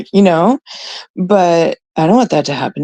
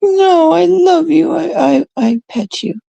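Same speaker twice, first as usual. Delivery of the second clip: a hint of baby talk to a plush toy; cooing; 10 semitones higher than usual. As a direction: coo it to a cuddly toy. Cooing to her stuffed toy.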